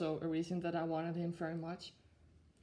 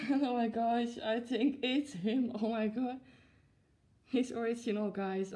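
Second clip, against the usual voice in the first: higher, louder delighted gush; thrilled discovery; 4 semitones higher, 5 dB louder than usual. Real, not acted. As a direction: laugh into it, amazed it's really the one. Sees that the plush is original.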